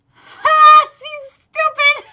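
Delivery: loud, high, slow laughing squeal; laughing outburst. Real, not acted. Reading sad line, bursts out.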